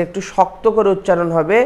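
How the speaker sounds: ordinary talk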